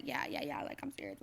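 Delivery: soft, breathy near-whisper; hushed reply. Retold or imitated. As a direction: say it under your breath, reassuring her. Fiancé confirming the proposal.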